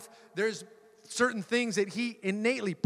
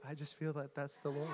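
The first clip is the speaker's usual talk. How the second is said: soft wife quote; 4 semitones lower, 13 dB quieter than usual. Retold or imitated. Wife's gentle quote retold.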